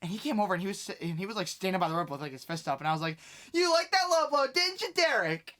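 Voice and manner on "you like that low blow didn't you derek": high, loud taunting call; heckling taunt. Retold or imitated. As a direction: jeer at him from ringside, reenacted. Heckling a wrestler, reenacted.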